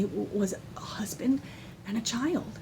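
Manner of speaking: soft hushed whisper; almost too moved to voice it; tearful whisper. Real, not acted. Mother whispering to viewers what God gave her.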